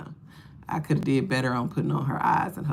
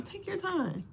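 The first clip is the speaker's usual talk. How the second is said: much higher and much softer; high soft aside; 13 semitones higher, 11 dB quieter than usual. Acted. Squeaky aside urging patience to the waiting man. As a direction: float it up like a tiny voice.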